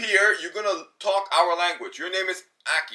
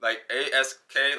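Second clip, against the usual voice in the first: ordinary talk, nothing marked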